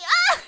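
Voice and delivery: short, very high loud shriek; sudden high yelp; comic shriek. Real, not acted. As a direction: squeal out in laughing agony. Cracking up in mock agony.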